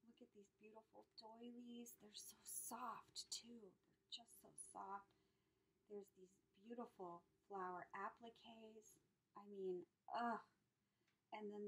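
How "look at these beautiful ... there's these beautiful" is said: tender awed whisper. Real, not acted. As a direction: whisper as if touching something delicate. Stroking soft doilies.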